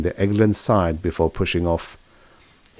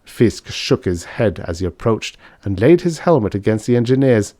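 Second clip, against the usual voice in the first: ordinary delivery, nothing marked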